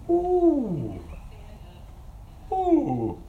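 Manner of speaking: high, drawn-out falling ooh; a stung, knowing ooh at the hard truth; mock wince. Real, not acted. Reacting to God's rebuke.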